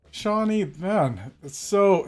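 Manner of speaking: usual speaking voice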